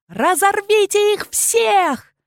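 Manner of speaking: very high, drawn-out screech; shrieking command. A narrator's reading. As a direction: screech the order at full pitch. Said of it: "завизжала королева".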